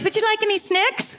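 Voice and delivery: high put-on Kiwi accent, "snicks" for snacks; a squeaky mock Kiwi waiter offering snicks. Host mocking the Kiwi "snicks", high mock Kiwi accent. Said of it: "people here say the word snicks".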